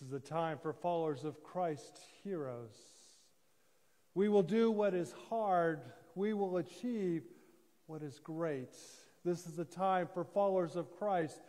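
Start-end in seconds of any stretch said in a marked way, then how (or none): none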